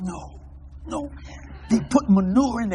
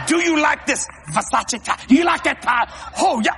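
shouted, higher pitched offer; excited shout; 7 semitones higher, 4 dB louder than usual. Retold or imitated. Preacher offering his tie to the king, shouting. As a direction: sell the tie with a happy shout.

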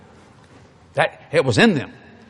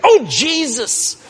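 high, slow, drawn-out cry to Jesus; spiritual exclamation; 11 semitones higher, 3 dB louder, much slower than usual. Retold or imitated. Grandmother sees the price and calls on Jesus. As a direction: mimic grandma calling on the Lord. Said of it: "she became spiritual".

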